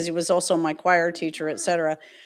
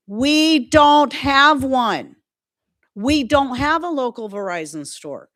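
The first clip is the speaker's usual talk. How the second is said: loud, higher, drawn-out insistence; exasperated retort; 6 semitones higher, 6 dB louder, slower than usual. Retold or imitated. Customer retelling argument with outsourced phone agents, exasperated. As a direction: spell it out slowly, fed up.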